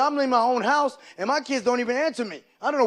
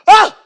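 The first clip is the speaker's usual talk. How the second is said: loud, high yelp of shock; outraged scream; 10 semitones higher, 16 dB louder than usual. Acted. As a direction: scream as if your game was just killed. Son reacts to game switched off.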